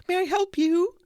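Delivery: very high, sing-song shopkeeper voice; a hammed-up creepy shopgirl greeting; creepy seamstress. Seamstress greeting the men at her counter, creepy high voice. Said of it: "that's more creepy than anything else".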